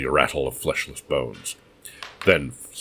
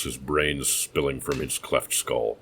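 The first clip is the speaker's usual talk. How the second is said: plain reading voice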